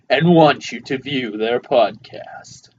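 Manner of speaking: slow, measured trailer voice-over; mock trailer announcer. Podcaster announcing their podcast coming soon, mock trailer voice.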